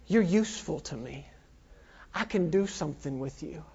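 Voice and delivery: low, hushed, unhurried assurance; soft low word from God; God speaking. Acted. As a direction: quietly voice God's approval. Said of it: "God said".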